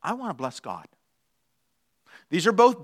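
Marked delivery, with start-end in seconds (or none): 0.0-0.9 soft, lower, humble dog; quiet voice of a worshipping dog; devoted dog. Acted. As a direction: say it low and reverently. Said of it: "And a dog says".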